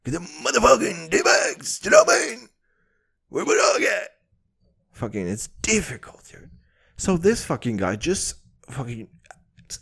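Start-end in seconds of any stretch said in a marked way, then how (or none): 0.0-4.1 fast, higher shouted growl; shouting like the rapper. Retold or imitated. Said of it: "he was sounding like this".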